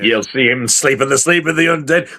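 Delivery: normal talking voice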